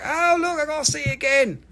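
very high, loud mimicry; exaggerated imitation, pitched far above normal; mocking a viewer. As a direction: squeal it like an overeager customer. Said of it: "You're like".

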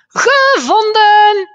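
hoge, luide, langgerekte jubel; gevonden roep. A narrator's reading. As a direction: roep triomfantelijk. Kinderen vinden opa. (kinderen roepen opa in de kast gevonden)

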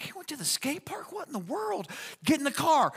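high, startled question about the skate park; a dad who can't believe his ears; shocked disbelief. Retold or imitated. Dad hears son went off.

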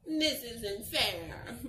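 slow, whimpering complaint; mock crying bear. The bear losing the underwear, slow mock whimper. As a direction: whine it like a hurt little bear. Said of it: "One bear cries".

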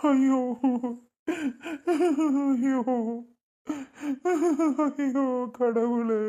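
wailing with sobs between the words; slow, drawn-out cry of despair; sobbing. Acted. Man breaking down as his family asks why, sobbing. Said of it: "ஏன்டா அழுகுற?".

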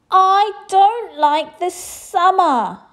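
high, loud, slow overheated whine; gasping. A narrator's reading. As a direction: gasp it out, too hot. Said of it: "she gasped".

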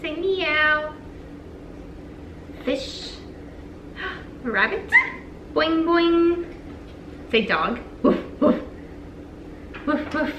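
bright sing-song picture-book voice; name each animal playfully for a baby; reading to a toddler. Acted. Mom reads animal book to toddler.

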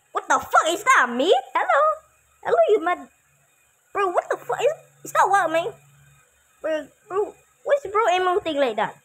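high, loud, freaked-out yelling. Real, not acted. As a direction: shout it in alarmed disbelief. Seeing his evil twin.

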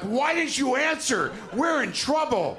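panicked guest pleading with the desk clerk; panicked plea. Retold or imitated. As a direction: beg her like the world is ending. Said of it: "I'm like".

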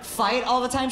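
high, disbelieving question on all; can you believe this, repeat it in shock; incredulous. Real, not acted. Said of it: "Uh, what?".

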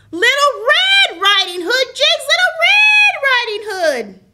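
building to a high, loud shout; losing patience, shouting the correct name; exasperated shout. Real, not acted. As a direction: yell the right name at him in frustration. Girl correcting him, exasperated.

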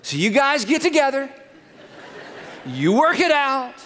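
high, self-righteous blame; mock-indignant. Adam tells God and Eve to apologize, mock-indignant.

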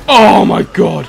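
slow, loud startled cry; startled shout. Real, not acted. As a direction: gasp it out in shock. Near miss.

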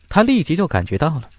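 plain reading voice

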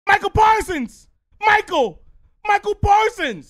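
high, stretched-out shouted name; kid yelling. Retold or imitated. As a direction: bellow the name to get his attention. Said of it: "But then he went".

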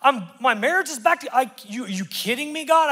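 ecstatic, can't-believe-it praise; overjoyed disbelief. Retold or imitated. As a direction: gush like someone just rescued. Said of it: "the person's like".

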